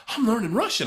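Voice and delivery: high, loud mock delight; retold excited boast. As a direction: crow it like a thrilled beginner. Retold delight at understanding Russian.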